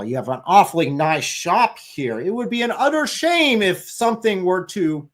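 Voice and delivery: high, mock-menacing racketeer voice; sinister protection-racket shakedown; mobster threat. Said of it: "we won't kill you if you pay".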